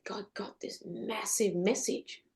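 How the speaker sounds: hushed, breathless whisper of revelation; awed whisper. Real, not acted. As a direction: whisper it like a secret from God. Revelation moment.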